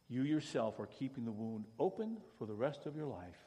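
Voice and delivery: ordinary delivery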